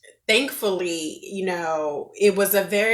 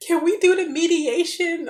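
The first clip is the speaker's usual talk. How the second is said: hopeful ask; 10 semitones higher, 6 dB louder than usual. Retold or imitated. Retelling asking staff for mediation.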